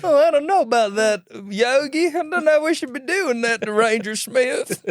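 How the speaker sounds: high, worried southern cartoon bear; southern Boo Boo type. Little bear doubting Yogi's plan, high southern character voice. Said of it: "southern cast of Yogi Bear".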